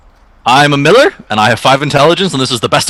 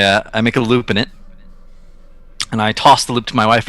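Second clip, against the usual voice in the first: ordinary talk, nothing marked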